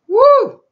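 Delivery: high, loud "woo"; short high-pitched whoop of awe. Real, not acted. Preacher reacting to God's word, high whoop.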